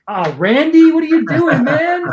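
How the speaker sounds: high, loud mock outcry at a prankster; mock exasperated yell. Hosts jokingly scold drink-pouring audience member, hammed-up yell. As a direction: call out in playful protest, voice shooting up.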